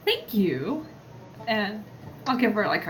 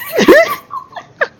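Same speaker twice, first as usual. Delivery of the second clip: loud laugh; 11 dB louder than usual. Real, not acted.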